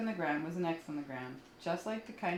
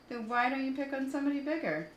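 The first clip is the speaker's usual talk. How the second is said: sweet taunt; 9 semitones higher than usual. Acted. Friendly ladybug taunts grouchy one.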